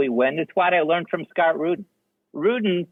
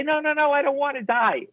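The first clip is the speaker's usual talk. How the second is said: high, pleading mock terror; mock panic; 10 semitones higher than usual. Studio executive backing away from the cliff, mock panicked. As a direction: beg off like a scared executive.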